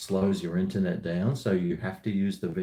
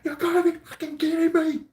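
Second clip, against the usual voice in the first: mock yell at footy. Fan raging at the footy on TV, mock yell. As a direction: yell it like the footy just went wrong. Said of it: "if I'm yelling and pacing and going".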